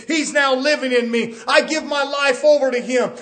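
high, loud, driving pulpit voice; fervent preaching. Real, not acted. Preacher urging congregation to yield to Jesus, fervent preaching. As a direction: build it into an urgent proclamation.

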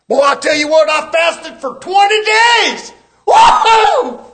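very high, loud shouting show-off; whooping braggart. Retold or imitated. Preacher mocks a man bragging about his fast, high shouting whoop. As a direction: shout the boast, whoop at the end.

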